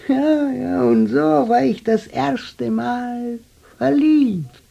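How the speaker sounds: high, bright rush of remembering; light up at the memory of first love; old man giddy about his first love; excited memory. Acted.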